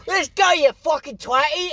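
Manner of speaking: high mock British voice; mock a cheeky Brit; British accent. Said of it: "British robot".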